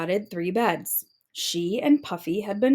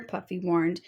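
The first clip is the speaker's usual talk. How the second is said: ordinary delivery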